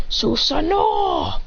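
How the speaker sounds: slow mock anime shout; summoning call. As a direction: stretch the move name into a battle cry. Summons technique in fight.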